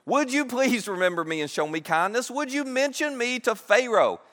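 high-pitched, insistent pleading; earnest plea. Acted. Joseph asks the cupbearer to remember him, pleading. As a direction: beg for a favor, pitch lifted. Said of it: "Joseph makes a plea".

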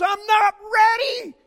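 high, loud, slow retort; wife's shout. Retold or imitated. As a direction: throw it right back at him. Wife yells back in car.

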